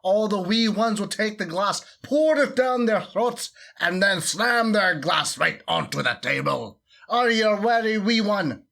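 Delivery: over-the-top Scottish brogue, loud and showy; Scottish accent. Pretend. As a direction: play a boisterous Scottish host rallying the wee ones. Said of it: "in his best Scottish accent".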